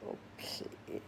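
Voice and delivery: tiny, whispered assent; reluctant agreement. Retold or imitated. Storyteller agreeing to a dreaded call, reluctant whisper. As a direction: give in under your breath.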